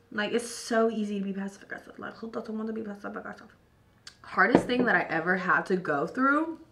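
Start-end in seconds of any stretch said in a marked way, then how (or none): 0.1-3.5 much softer, confiding tone; quiet aside. Real, not acted. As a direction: drop to a near-whisper.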